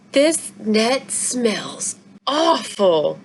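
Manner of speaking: very slow disgusted voice; disgusted father. A narrator's reading. Father complaining about the net's smell, slow disgust. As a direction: draw it out in distaste.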